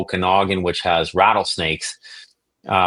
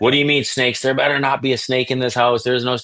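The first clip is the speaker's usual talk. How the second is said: higher, hammed-up outraged father; mock angry dad; 5 semitones higher than usual. Dad confronting his son about snakes, mock outrage. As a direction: play the dad losing his mind, half mocking.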